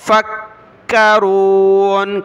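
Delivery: फक्करून with a firm stop held on क्क; long final oon after a hard doubled k in फक्करून; hard doubled kaaf. Shown as a demonstration. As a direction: slowly hold the k of फक्करून, then release. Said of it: "काफ को सख्त किया तशदीद की वजह से".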